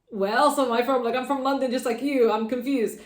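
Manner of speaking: retelling her baffled answer to people who ask where she's from; exasperated retort. Retold or imitated. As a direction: protest as if the question makes no sense. Retelling her reply to people asking.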